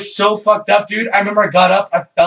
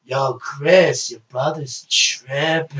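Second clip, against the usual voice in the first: imitating Marcus; 3 semitones lower, 4 dB quieter, much slower than usual. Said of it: "Just like that".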